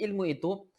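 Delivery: ordinary talk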